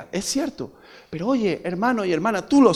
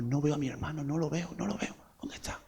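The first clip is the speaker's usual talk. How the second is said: hushed worry; 16 dB quieter than usual. Retold or imitated. Searching for a missing brother.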